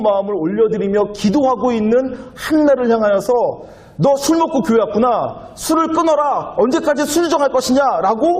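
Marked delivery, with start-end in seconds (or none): 4.0-8.3 high, sharp scolding priest's voice; stern rebuke. Retold or imitated. Priest Eli scolding praying Hannah, a high mock rebuke. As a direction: rebuke her like a scandalized old priest. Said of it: "오해하고서는 책망하였어요".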